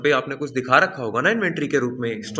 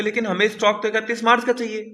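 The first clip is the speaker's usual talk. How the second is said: higher mock-worried business owner; worried client; 8 semitones higher, 3 dB louder than usual. Teacher voicing a client pleading with the auditor, higher and worried. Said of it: "आप बोलोगे".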